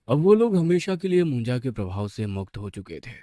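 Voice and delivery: ordinary delivery, nothing marked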